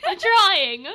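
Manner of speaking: high, loud, helpless wail; laughing protest. Real, not acted. Host defending the failed accent, laughing protest. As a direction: protest through giggles.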